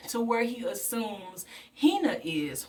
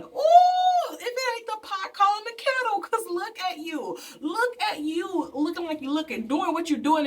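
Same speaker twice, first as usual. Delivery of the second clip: shrieking high "Ooh", then a high, sassy taunt; sassy call-out; 8 semitones higher than usual. Acted. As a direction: read him for filth, way up high. Mocking the hypocrite character.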